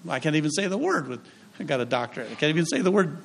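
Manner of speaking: ordinary talk, nothing marked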